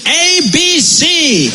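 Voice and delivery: very slow, high, spelled out; pompous teacher. Acted. Colonizer teaches the locals English, slow high drill. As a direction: drill the letters like a stiff schoolmaster.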